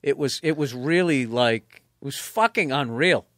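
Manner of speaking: usual speaking voice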